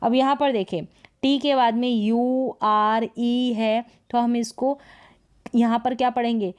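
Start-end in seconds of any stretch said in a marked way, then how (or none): none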